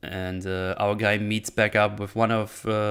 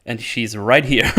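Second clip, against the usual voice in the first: usual speaking voice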